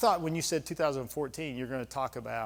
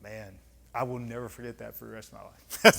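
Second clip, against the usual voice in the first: normal talking voice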